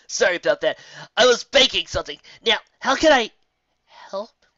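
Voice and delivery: put-on a busy kid greeting visitors; Spike. Spike voiced, cheerful.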